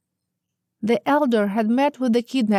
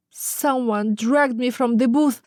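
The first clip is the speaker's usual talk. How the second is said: usual speaking voice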